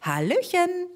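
high chirpy hello; twittering bird. Acted. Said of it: "zwitscherte er".

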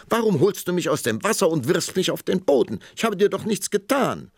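put-on high, loud, indignant young princess. A narrator's reading. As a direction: scold him in a high royal huff.

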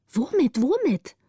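high, impatient, anxious repeat; urgent questioning. A narrator's reading. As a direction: press for the answer in alarm. Anxious children press the lookout. (drängend und ängstlich nachgefragt)

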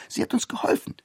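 ordinary delivery

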